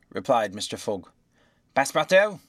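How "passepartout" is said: high, loud summons; loud, raised-pitch official query; clerk's roll call. A narrator's reading. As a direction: call out the name across the courtroom. Clerk calls the roll in court.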